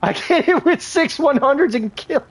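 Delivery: high, thrilled report of the kill; excited boast. Real, not acted. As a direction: brag about the hit, voice way up. Boasting right after the kill.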